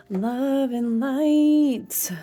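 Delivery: high, very drawn-out sing-song; mock-sweet blessing. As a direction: stretch it out high like an airy blessing. Mock channelling of spirit, sing-song.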